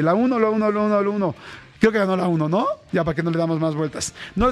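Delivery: usual speaking voice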